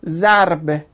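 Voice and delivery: «ضرب» said zar-b, no vowel after the r; sukun on R. Shown as a demonstration. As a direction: close the r straight onto the b in «ضرب». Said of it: "روی را ساکن دیگه". («ضرب» با راء ساکن: ضَرْبَ)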